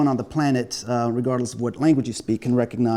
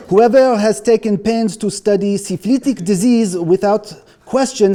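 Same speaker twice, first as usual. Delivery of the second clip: mock French accent, raised and grand; 7 semitones higher, 5 dB louder than usual. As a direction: read the quote as a hammed-up Frenchman. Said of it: "I can do this in a French accent".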